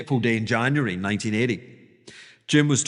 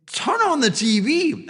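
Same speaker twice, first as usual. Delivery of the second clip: high and louder "Turn on the T V"; urgent broker; 11 semitones higher, 4 dB louder than usual. Retold or imitated. Broker telling Jim to look at the news, high and urgent. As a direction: bark it like an urgent order. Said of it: "he's like".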